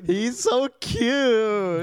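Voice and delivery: very slow, high, gushing; drawn-out mock swoon. Fawning over character.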